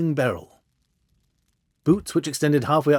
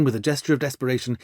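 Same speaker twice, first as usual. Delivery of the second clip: ordinary delivery, nothing marked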